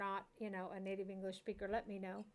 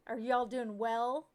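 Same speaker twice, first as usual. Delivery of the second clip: slow, louder, overly correct "well"; mock proper; 6 dB louder, slower than usual. Shown as a demonstration. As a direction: drag out "well" as if correcting your grammar. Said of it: "one would say properly?".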